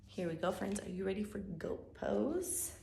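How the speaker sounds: normal talking voice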